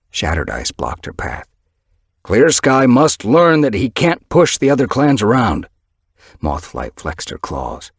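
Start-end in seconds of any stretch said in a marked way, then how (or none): 2.2-5.7 hostile warrior. Acted. Shattered Ice blocks her path.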